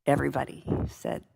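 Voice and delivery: ordinary talk, nothing marked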